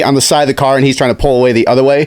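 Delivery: usual speaking voice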